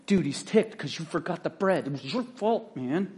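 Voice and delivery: a hint of a sulky dude blaming his buddy; bickering bro. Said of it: "You know what these bros do?".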